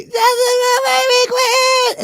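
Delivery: high, slow, sing-song mock wail; mother's fake-crying chant for the baby. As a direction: ham up a tearful chant, high and drawn out. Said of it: "she's crying and she's like".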